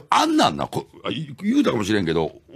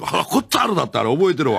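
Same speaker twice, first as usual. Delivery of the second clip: normal talking voice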